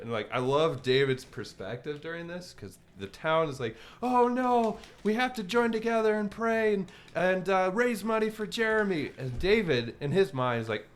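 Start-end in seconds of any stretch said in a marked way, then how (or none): 4.0-9.1 higher, sing-song fake sympathy; mocking pious concern. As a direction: mimic the town's overdone worry. Town's pious reaction, mocked.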